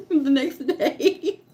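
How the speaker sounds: laughing through the words; giggling as she admits she came back. Real, not acted. To the viewers about returning to work, laughing. Laughing at her own nerve.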